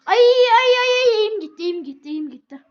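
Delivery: high, very loud panicked yelp; panicked shouting. Real, not acted. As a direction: yell in sudden panic. Panicking as aim slips.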